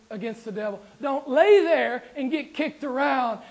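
ordinary delivery

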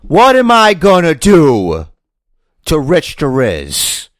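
slow, loud promo question; drawn-out heel taunt, building up; wrestling promo. Pretend. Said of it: "do it like a wrestling promo".

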